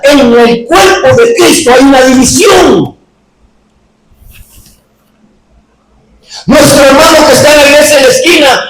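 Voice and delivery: predicación encendida, tono alto y sostenido; prédica enérgica. Acted. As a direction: proclama con fuerza ante la iglesia. Predica a plena fuerza. (predicación ferviente)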